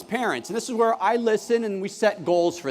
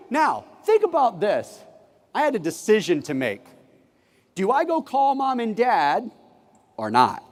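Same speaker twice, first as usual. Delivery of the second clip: ordinary delivery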